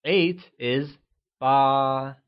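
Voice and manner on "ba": Ba held high and level. Shown as a demonstration. Teacher modelling Ba with a high level tone for learners. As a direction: keep Ba flat and high. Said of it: "pronounced with a high level tone".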